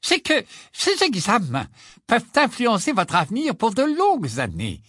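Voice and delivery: high, squeaky professor voice; squeaking. Acted. Flitwick lectures the class, squeaky. Flitwick continues his speech.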